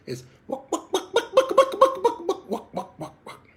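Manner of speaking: bucks climbing high then fading out; treaded double cluck. Shown as a demonstration. Instructor demoing a goose getting excited and settling, rising bucks. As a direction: run the bucks up and back down. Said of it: "It almost becomes a double cluck".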